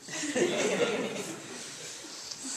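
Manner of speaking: a fond laugh at the memory. Real, not acted. Remembering useless boxes with friend.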